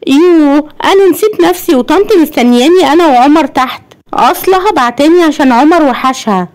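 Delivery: high-pitched wife, suddenly remembering; زوجة افتكرت فجأة. A narrator's reading. (صوت ست عالي افتكرت حاجة فجأة)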